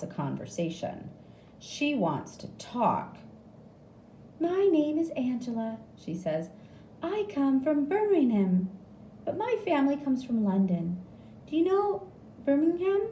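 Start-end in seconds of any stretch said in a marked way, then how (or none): 4.4-13.0 high, friendly nurse voice; lightly voiced chatty nurse. A narrator's reading. Nurse chatting about Birmingham, high and friendly.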